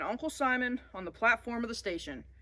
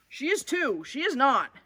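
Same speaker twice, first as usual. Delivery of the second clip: put-on high, louder childish bickering; two kids snapping back and forth; squabbling brothers; 6 semitones higher, 5 dB louder than usual. Little brothers fighting on the train, loud and high.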